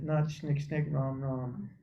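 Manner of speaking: mock rushed mumble "shnick, shnick, rom, rom"; mumbled fast chant. Shown as a demonstration. Mocking rushed japa, fast mumble. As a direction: rattle off garbled mantra syllables. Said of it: "Going fast".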